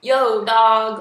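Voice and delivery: loud imitation hip-hop greeting; hip-hop homie. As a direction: call it out like a mock rapper. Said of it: "a little too hip-hop-ish".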